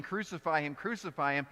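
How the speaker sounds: usual speaking voice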